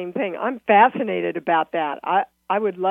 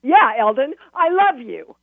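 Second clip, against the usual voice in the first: high, loud mocking declaration; 4 semitones higher, 5 dB louder than usual. Shown as a demonstration. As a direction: toss it off, half mocking. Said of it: "Or I can say".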